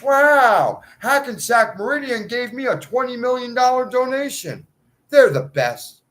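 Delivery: loud and high, gushing; mock delight. Imagining himself thanking donors with loud mock delight. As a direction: gush like a thrilled fan. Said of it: "And I'll say".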